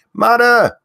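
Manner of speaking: loud, high name call-outs; yelling names. Real, not acted. Host yelling chat names to early viewers, loud and high. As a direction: belt each viewer's name out. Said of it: "if you want me to yell your name".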